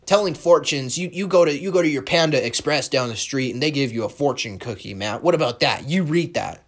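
high, loud mock voice of a smug heckler; mocking arguer. Mimicking listeners who argue with him, high and mocking. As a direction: parody the know-it-all who argues back. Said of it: "wanna argue with me and say".

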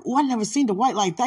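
high, loud, fast amazement; amazed. Retold or imitated. Recalling watching Dwight in praise, amazed and high. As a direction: gush at the praise video. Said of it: "and I was like".

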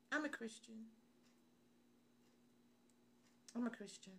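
small, soft, meek voice; timid confession. Acted. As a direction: say it shyly, barely owning it. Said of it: "Why don't we have that confidence?".

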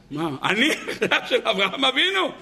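high, laughing imitation of an old woman; laughing high granny voice mocking her pride; mocking old lady. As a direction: send up the granny's boast with a laugh. Mocking the grandmother's claim.

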